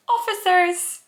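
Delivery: high, drawn-out squeal at full volume; sudden loud high-pitched cry; really loud exclamation. Acted. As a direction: belt it out, stretched and high. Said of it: "that was really loud".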